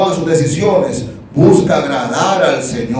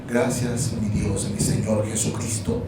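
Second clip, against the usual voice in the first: softer and lower, gracias mi Dios a mi Señor Jesucristo; sincere thanks; 6 semitones lower, 9 dB quieter than usual. Shown as a demonstration. Preacher showing the congregation the right thanks, hushed and devout. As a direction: say it low and devout, barely voiced. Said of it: "No, digan".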